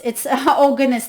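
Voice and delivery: ordinary talk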